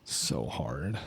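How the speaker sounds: low, slow, quiet lament; mock self-pity. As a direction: sigh it out as a joking martyr. Joking complaint about store trips.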